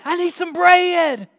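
slow, drawn-out pleading holler; insistent calling. Acted. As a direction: stretch out a stubborn request. Caller keeps insisting.

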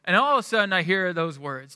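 ordinary talk

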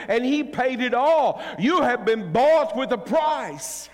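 loud, high-pitched sermon delivery; full-force preaching. Real, not acted. Preacher telling congregation they were bought with a price, full-force preaching. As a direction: preach it loud, driving each phrase home.

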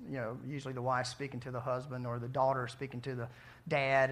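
ordinary talk

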